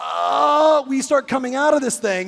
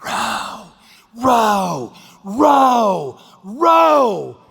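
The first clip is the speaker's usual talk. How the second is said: building from whisper to shout; 4 dB louder, much slower than usual. Retold or imitated. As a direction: start whispered, grow into a loud yell. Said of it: "this gal's back there going".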